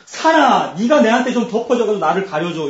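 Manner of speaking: high, loud acted cry to a mountain; calling out in plea. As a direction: beg loudly to be covered. Acted cry begging mountain for cover.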